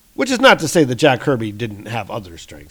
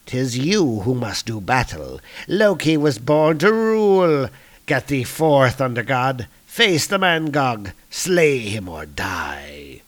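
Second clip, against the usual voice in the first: slow right down for a dark finish; imperious villain. Acted. Usurper sending his brother to battle, imperious then ominous.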